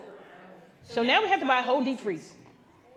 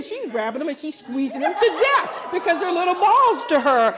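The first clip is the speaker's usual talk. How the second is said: normal talking voice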